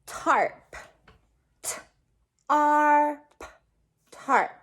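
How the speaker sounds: slow letter-by-letter spelling of "tarp"; teacher sounding out tarp; sounding out a word. Shown as a demonstration. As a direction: spell "tarp" as T, R, P, then say it whole. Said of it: "our first word is going to be".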